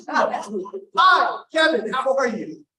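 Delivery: high, loud phone hello; put-on enthusiastic recognition; imitated delighted greeting. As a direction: gush the greeting, stretched out and high. Margaret recognizes caller on phone.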